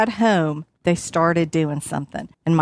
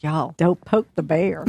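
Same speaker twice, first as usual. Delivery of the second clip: slow warning; 3 semitones lower than usual. Retold or imitated. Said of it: "He's like".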